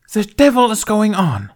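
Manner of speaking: sharp, high, loud angry hiss. Acted. Thane hissing at the senators over the commotion. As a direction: spit the words out in outrage. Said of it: "Thane hissed".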